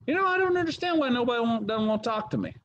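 high-pitched, hammed-up bewilderment; mock complaint. Pastor mimicking people nobody wants to talk to, high mock complaint. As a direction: whine it high, imitating the baffled complainer.

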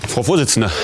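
ordinary delivery

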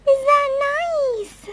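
high, loud, slow coo to a pet. Real, not acted. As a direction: ask the pet sweetly, drawn out. Cooing baby talk to pet.

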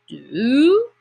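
long rising bellow, loud. A narrator's reading. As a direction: stretch it into a swelling bellow. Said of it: "it bellowed".

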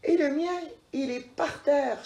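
ordinary delivery, nothing marked

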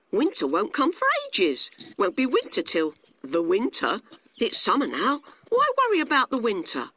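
high, lively grasshopper voice; carefree grasshopper, dismissive. Acted. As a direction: brush off the worry in character. Narrator plays carefree grasshopper.

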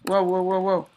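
loud, sudden startled whoas. Real, not acted. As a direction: blurt it out in shock. Startled gamer exclaiming.

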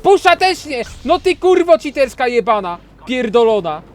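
high, loud furious curses at the boss; furious yelling. Real, not acted. Gamer cursing the boss after his push fails, furious high yelling. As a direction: scream at the cheating enemy.

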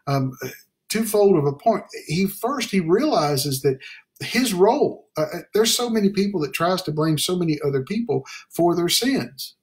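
usual speaking voice

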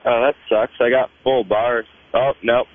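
ordinary delivery

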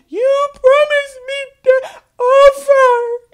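very high, loud wailing mockery; mock tantrum. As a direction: wail it slowly like a hammed-up tantrum. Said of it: "lose your temper. It's like".

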